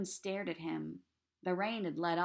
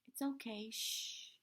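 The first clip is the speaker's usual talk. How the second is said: softer, soothing hush; calm him like a scared little kid; soothing a child; 4 semitones higher, 8 dB quieter than usual. A narrator's reading. Daughter comforting her crying father, softly soothing. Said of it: "like he was a scared little kid".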